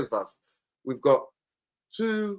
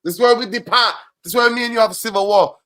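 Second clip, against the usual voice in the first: higher, louder, declaring war on the Glazer take; loud mock-dramatic protest; outraged shout; 9 semitones higher, 8 dB louder than usual. Real, not acted. To his friend defending the Glazers, an outraged shout.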